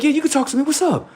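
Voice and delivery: very high, eager voice; excited, high-pitched imitation; happy brother. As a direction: imitate a thrilled brother welcoming a chat. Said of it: "he was so happy".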